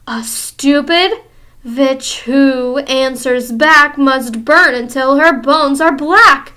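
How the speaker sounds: slow, loud menacing chant; chilling voice. Acted. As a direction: chant the threat coldly. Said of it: "a voice that made my blood run cold".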